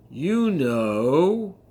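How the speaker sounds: parrot voice. Acted. Parrot beginning its lesson to the woman, loud. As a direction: start the reveal in a squawky parrot voice.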